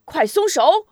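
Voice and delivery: loud, high, sudden order; hurried command shout; urgent shout. A narrator's reading. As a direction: yell the order across to your man. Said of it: "忙向大汉喊一声".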